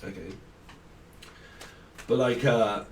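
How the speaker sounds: ordinary talk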